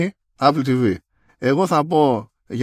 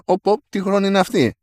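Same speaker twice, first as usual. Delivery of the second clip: higher, mock-alarmed company voice; mock Apple voice; 8 semitones higher than usual. Podcast host voicing Apple noticing the repair drags, higher and mock-alarmed. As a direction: play the impatient company catching the delay.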